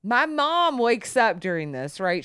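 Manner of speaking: usual speaking voice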